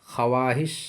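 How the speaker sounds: ख्वाहिश with the waw sounded, kha-waa-hish; spelled-out reading. Shown as a demonstration. Teacher reads what is written, ख्वाहिश as kha-waa-hish. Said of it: "लिखा हुआ है".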